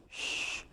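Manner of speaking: long hissed "shh"; unvoiced hush; shushing. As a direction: shush a noisy complainer. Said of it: "I go".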